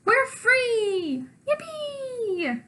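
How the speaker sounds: high, thrilled whoop; joyful cheer. Acted. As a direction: cheer with wild relief. Cheering when monster leaves.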